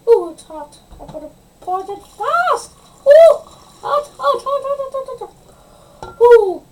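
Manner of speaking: shrieking high panic at the hot water; phobic panic. Real, not acted. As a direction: yelp in real alarm, high and loud. Boiling water spills on her.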